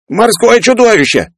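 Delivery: high, drawn-out terrified squeal; squealing yell. A narrator's reading. Peller screams at sight of monster, squealing. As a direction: yell until the voice breaks into a squeal. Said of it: "срываясь на визг, заорал Пеллер".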